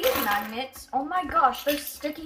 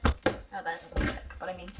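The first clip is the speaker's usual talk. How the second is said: normal talking voice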